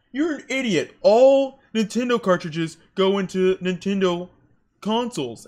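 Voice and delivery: loud, high smug insult; high snotty customer. Retold or imitated. Customer scolds the clerk, high and loud. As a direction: sneer it like a know-it-all.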